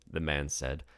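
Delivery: ordinary delivery, nothing marked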